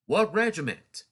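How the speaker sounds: loud sharp Southern sentry challenge; a Southern guard's loud demand; Southern accent. Retold or imitated. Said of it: "talking in a Southern accent".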